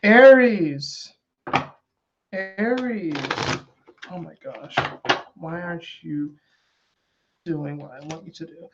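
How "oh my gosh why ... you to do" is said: soft, exasperated grumbling at the cards; exasperated mutter. Real, not acted. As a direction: mutter in playful frustration. Frustrated at cards not shuffling.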